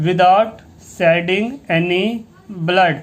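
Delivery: very slow read-along. Shown as a demonstration. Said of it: "आप मेरे साथ इसको पढ़ सकते हैं".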